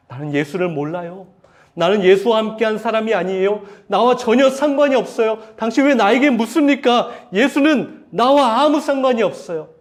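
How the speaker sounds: loud, high, furious denial; angry shouting denial. Retold or imitated. Peter denying Jesus to his accusers, angry shout. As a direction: shout it at the people questioning you. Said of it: "큰 소리치고 그렇게 화를 냈어요".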